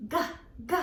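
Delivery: "G" as a short hard guh sound, repeated; teacher modelling the letter sound "G" for kids. Shown as a demonstration. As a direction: say "G" as just the sound guh, crisp. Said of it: "Can you make that sound with me?".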